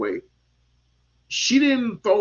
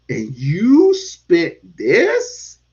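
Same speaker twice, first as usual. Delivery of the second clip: indignant challenge; 7 semitones higher, 6 dB louder than usual. Retold or imitated. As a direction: hit it hard and drag out the disbelief. Retells own indignant challenge.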